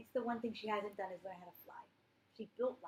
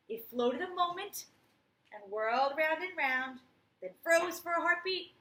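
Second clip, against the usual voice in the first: suspense build; 6 semitones higher, 7 dB louder than usual. A narrator's reading. Dramatic crash build-up read.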